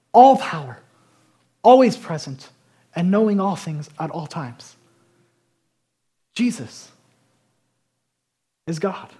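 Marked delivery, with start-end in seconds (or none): 0.1-4.7 loud punch. Real, not acted. Preaching emphasis.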